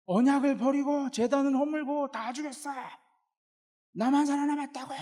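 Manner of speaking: high, strained wail of grievance; loud burst, then sustained high-pitched protest; indignant Elijah. Acted. Elijah repeating his complaint to God word for word, high and indignant. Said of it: "똑같은 말을 토시 하나 안 하고".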